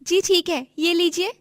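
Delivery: put-on high-pitched young vendor's voice; obliging vendor. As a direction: hand it over obligingly. Vendor serves customer. (ऊँची, विनम्र दुकानदार आवाज़)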